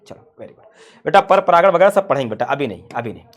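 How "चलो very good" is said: whispered praise. Real, not acted. Praising after mock answer.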